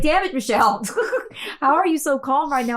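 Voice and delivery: half-laugh, half-complaint at Michelle; mock scolding with laughter; laughing exasperation. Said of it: "I was like".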